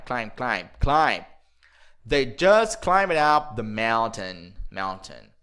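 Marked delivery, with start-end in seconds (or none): 2.1-5.3 slow, linked "climbed up"; slow model sentence. Shown as a demonstration. Teacher slowing the sentence down for students. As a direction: stretch it out for repeating.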